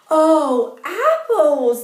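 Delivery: high, loud delighted oh apples; excited cry. Acted. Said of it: "the girl cried".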